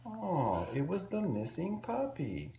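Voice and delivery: spring the surprise with delight; delighted reveal. A narrator's reading.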